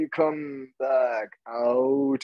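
very slow. Shown as a demonstration. Instructor easing students out of the posture, very slow. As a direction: come back out, stretched and unhurried.

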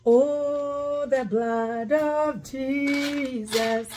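ordinary talk, nothing marked

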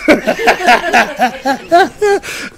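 loud, high peal of laughter; real amused laughing fit. Real, not acted. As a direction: crack up at the story. Laughs at retold exchange.

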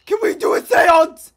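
very loud, high yell; excited shout. Real, not acted. Streamer asks friend for a seance, excited shout. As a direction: shout the request at full volume.

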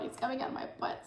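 whispered, fast and pleading; desperate whisper. Retold or imitated. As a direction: hiss it urgently. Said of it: "I was like".

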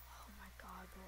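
hushed scared whisper. Real, not acted. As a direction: breathe it out in fear. Hiding, whispering in fear.

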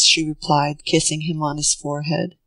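ordinary delivery, nothing marked

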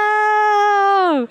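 high, loud hammed-up wail; mock cry out. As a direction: fake a pained yelp, then take it back. Mock outburst after jazz joke.

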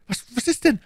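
very high, stammered alarm; panicky question. A narrator's reading. Bonacieux asks Milady what she saw, panicky. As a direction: ask in a high, frightened voice.

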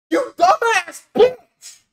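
very high, slow, screamed insult; shrieking insult at a rival streamer; furious scream. Real, not acted. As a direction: scream it out in real fury.

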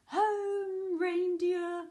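high, loud, drawn-out call; weary but carrying Santa holler; sleepy call. A narrator's reading. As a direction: call out to the reindeer. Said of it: "Sleepily, Santa rubbed his eyes and called".

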